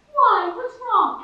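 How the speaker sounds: high, pushy mock concern; concerned questioner. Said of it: "then they ask".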